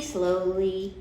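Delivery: ordinary delivery, nothing marked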